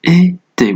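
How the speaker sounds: "إي تي" as é-té with thin closed vowels, pitched high. Shown as a demonstration. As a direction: say "إي تي" with the mouth nearly closed on each é. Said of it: "ويكون نطق الحرف مرقق".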